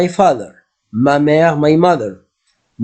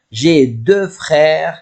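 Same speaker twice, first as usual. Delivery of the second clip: slow model phrase; slower than usual. Shown as a demonstration. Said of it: "you say".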